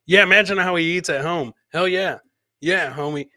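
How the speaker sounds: normal talking voice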